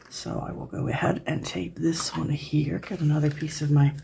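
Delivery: ordinary delivery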